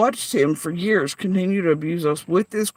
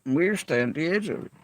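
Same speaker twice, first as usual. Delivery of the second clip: normal talking voice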